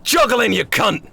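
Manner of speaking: high, slow contemptuous order; angry chef. Acted. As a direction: stop him mid-trick.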